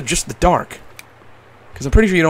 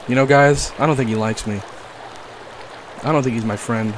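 ordinary delivery

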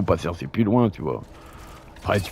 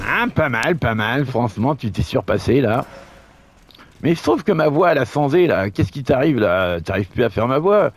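higher teasing sidekick voice; a cheeky buddy, pitched up; Roger voice; 5 semitones higher than usual. Pretend. Second voice, Roger, talks back.

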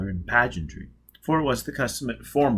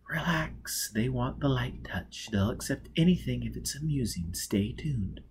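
much softer, hushed words to his companion; murmured aside; 2 semitones higher, 6 dB quieter than usual. Acted. Foyle quietly coaching Robin at the ball, hushed.

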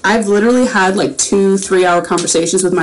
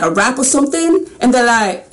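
ordinary delivery

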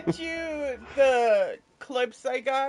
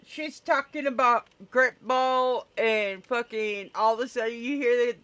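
ordinary talk, nothing marked

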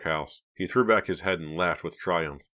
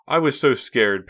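higher, louder, drawn out; pitched-up, emphatic admission; keyed-up confession; 7 semitones higher, 8 dB louder, slower than usual. A narrator's reading. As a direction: admit it with lingering excitement. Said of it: "Pam confessed".

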